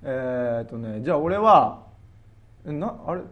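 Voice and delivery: usual speaking voice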